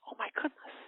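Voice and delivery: unvoiced, worried whisper; whispered fright; whispered alarm. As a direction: gasp it under your breath. Son sneaking in, fearing intruders.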